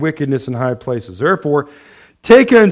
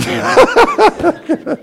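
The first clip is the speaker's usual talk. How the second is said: high rattling cackle; joyful laugh; 13 semitones higher than usual. Real, not acted. Preacher teasing the congregation, high joyful cackle. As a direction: burst out in high, staccato laughter. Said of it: "I'm laughing 'cause I'm joyful".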